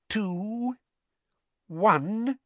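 high, drawn-out rising counts; suspenseful countdown. A narrator's reading. Mister Weasley counting down on his watch, tense and rising. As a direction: stretch each number, holding the suspense.

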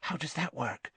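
soft, high, breathless question; breathless with interest. A narrator's reading. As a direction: ask in eager, hushed wonder. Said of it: "breathless with interest".

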